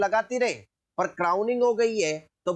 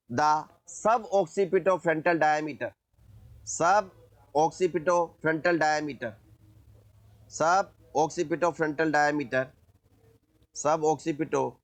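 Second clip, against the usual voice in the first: slow dictation; much slower than usual. Shown as a demonstration. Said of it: "teacher dictates notes slowly".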